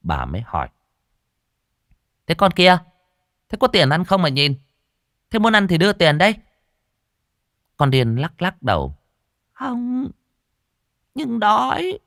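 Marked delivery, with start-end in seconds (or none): 2.3-6.4 higher, pushy stall owner; brusque challenge. A narrator's reading. Stall owner confronting a hungry girl staring at the food, brusque. 9.5-12.0 very high, drawn-out little plea; pleading beggar girl. A narrator's reading. Mad girl telling the stall owner she is hungry, plaintive.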